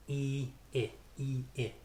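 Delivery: long tense "E", then short relaxed "I"; "E" tight, "I" loose; tense-lax vowel contrast. Shown as a demonstration. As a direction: alternate "E" as ee with "I" as in "it". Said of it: "Not E".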